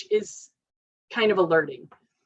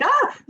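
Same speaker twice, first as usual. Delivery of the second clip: mock yell; 4 dB louder than usual. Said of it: "they yell at me and I'm like".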